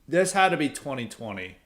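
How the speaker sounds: ordinary delivery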